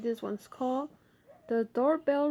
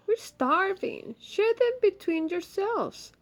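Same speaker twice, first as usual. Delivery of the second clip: Ma's voice; 10 semitones higher, 4 dB louder than usual. Acted. Ma voiced in story.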